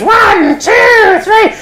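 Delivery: high, drawn-out puppet count; showy high countdown before a throw; Punch counting down. Acted. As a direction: count up high, teasing the crowd. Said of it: "He goes".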